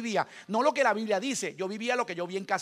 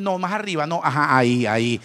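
louder, lower, sinking into relief; satisfied back-scratch; 4 semitones lower, 9 dB louder than usual. Retold or imitated. Father guides mother scratching his back, loud and pleased. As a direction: point her to the itch, then relax into it.

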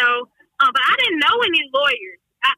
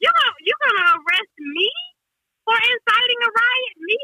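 incredulous; 8 semitones higher than usual. Retold or imitated. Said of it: "And I'm like".

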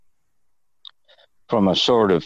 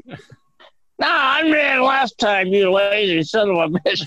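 mock roommate bickering; 13 semitones higher than usual. Roommate refusing to fetch beer, high mock-indignant voice. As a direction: whine at your lazy roommate in a put-on voice. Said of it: "We'd argue".

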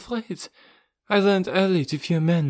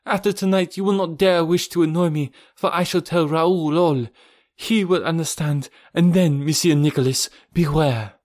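higher, louder, defiant woman's voice; defiant Olga; 7 semitones higher, 3 dB louder than usual. Acted. Olga warns Rokoff she will tell Raoul, defiant. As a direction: rise to a threat at the end.

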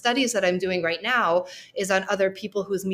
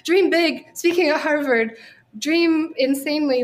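higher, louder mock-grand exclamation; 7 semitones higher, 4 dB louder than usual. Opening her Harvard talk with a mock-grand boast. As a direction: announce it like a wild dream come true.

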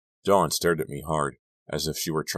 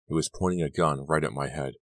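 usual speaking voice

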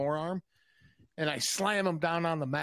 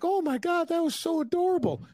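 high-pitched mock gushing lady voice; gushing ladies; 13 semitones higher than usual. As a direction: imitate a woman melting over a cute kid, pitched way up. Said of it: "And they're like".

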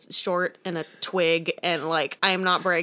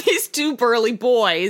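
mock dramatic; 5 semitones higher, 7 dB louder, slower than usual. Savoring the phrase comically.

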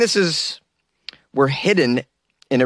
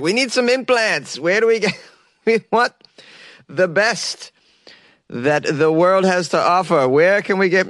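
mock researcher voice; 4 semitones higher than usual. Acting out the Chinese researchers.

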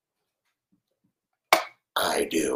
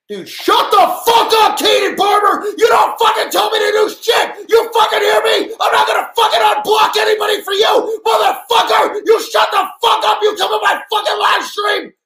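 much higher, much louder raw screaming fury; screaming rage; 15 dB louder than usual. Real, not acted. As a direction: scream him down at full force. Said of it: "I almost just had a fucking aneurysm".

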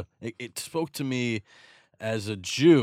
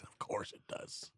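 breathy aside, almost unvoiced; whispered retort; 19 dB quieter, slower than usual. Real, not acted. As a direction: drop to a whisper for the jab. Muttered retort in an argument.

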